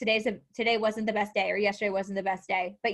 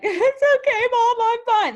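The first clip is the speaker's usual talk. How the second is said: much higher and louder, forced bright cover-up; fake cheerful reassurance; 13 semitones higher, 10 dB louder than usual. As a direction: sing it out high like nothing's wrong, hiding a bad day. Pretending to mom she's fine.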